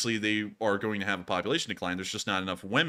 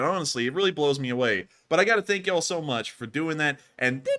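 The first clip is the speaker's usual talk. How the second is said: ordinary delivery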